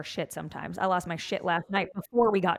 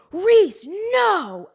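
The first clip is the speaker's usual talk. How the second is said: high, loud stop command; loud parental no; sharp warning shout; 13 semitones higher, 10 dB louder than usual. Retold or imitated. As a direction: yell it to stop a toddler near glass. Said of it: "he just goes".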